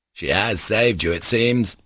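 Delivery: usual speaking voice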